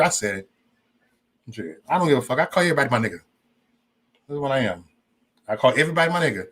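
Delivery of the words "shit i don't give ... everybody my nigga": ordinary talk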